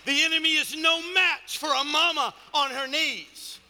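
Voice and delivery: high, shouted sermon climax, easing at the very end; full-force preaching. Real, not acted. Preacher declaring the enemy no match for praying believers, shouted fervour. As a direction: cry it out to the whole church with punched key words.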